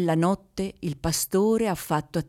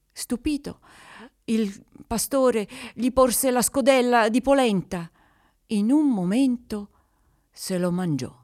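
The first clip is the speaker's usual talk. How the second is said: usual speaking voice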